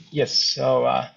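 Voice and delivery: ordinary talk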